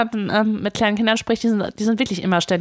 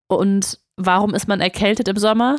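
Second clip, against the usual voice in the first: ordinary talk, nothing marked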